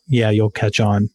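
ordinary delivery

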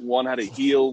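usual speaking voice

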